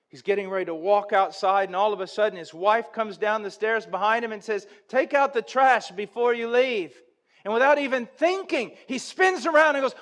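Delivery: loud, high preacher's build-up; impassioned sermon storytelling; full-force preaching. Acted. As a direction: drive the story home from the pulpit.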